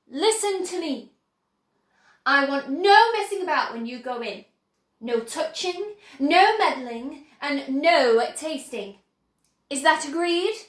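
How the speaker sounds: firm, loud laying down of rules; no nonsense, every no pressed hard; strict host warning guests; stern warning. Acted. Wonka lays down the rules.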